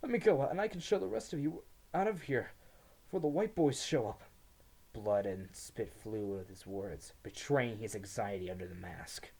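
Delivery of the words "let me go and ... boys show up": high, loud rehearsed pleading; pleading prisoner. A narrator's reading. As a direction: plead with a false calm mask. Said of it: "rehearsed mask".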